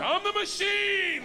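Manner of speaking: high, drawn-out loud roar; triumphant shout. Acted. Comic repeating his blunder to the gangster, triumphant shout. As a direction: roar it out, stretching the words.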